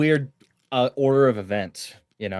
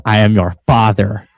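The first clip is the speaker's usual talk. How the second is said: louder hammed-up yelling; mock furious rant; 8 dB louder than usual. [name] yelling at Yam live on air, hammed-up fury. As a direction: rant at her like a raging dad. Acted quarrel, [name] ranting.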